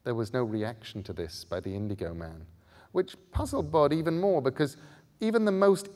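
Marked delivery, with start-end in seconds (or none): none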